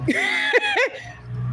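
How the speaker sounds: high-pitched burst of laughter. Real, not acted. Streamer amused by her own retort, high laugh.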